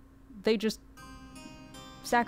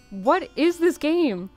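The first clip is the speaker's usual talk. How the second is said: baffled; 6 semitones higher, 4 dB louder, slower than usual. Real, not acted. Baffled by the weird game turn.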